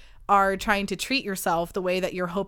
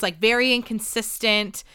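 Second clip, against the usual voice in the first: usual speaking voice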